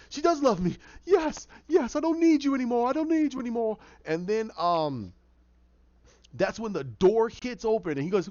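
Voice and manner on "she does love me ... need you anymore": high, excited, pouring out; triumphant relief rising well above normal pitch; elated outburst. Retold or imitated. Jervis reading her note, overjoyed and defiant.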